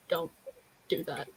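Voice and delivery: ordinary talk, nothing marked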